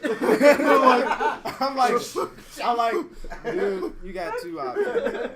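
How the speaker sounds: laughing. Retold or imitated. Laughing while retelling threat.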